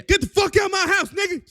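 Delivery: high, loud furious outburst; angry yell. Acted. Sam yelling at Isaiah to get out, high angry shout. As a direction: throw him out with a shout. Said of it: "Sam like".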